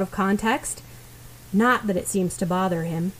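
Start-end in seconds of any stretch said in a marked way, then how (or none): none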